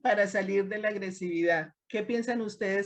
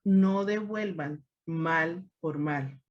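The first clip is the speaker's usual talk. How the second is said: slow quote; 3 semitones lower, slower than usual. Retold or imitated. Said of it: "donde nos dice el Señor".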